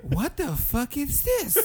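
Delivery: slow, high retold disbelief; bewildered. Man finding the back of the restaurant, slow bewilderment. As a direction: stretch it out high, totally thrown.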